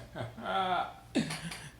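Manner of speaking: high-pitched chuckle; laugh. Real, not acted. Laughs at own situation.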